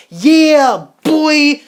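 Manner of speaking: loud, high whoop of victory; triumphant cheer. Acted. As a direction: shout it like you just won. Germany celebrating, voiced triumphantly.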